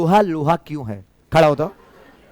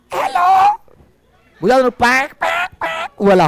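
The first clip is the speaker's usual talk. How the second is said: much higher mock girl's voice; 10 semitones higher, slower than usual. Teacher mimics a girl for the class, high-pitched. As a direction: squeak it up like a girl. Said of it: "अब लड़की के आवाज में".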